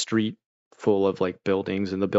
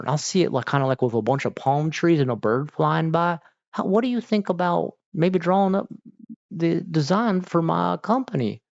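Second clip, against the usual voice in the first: hammed-up country accent, building higher; 8 semitones higher, 3 dB louder than usual. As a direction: imitate a parent with a broad country accent. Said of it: "I give him a country accent".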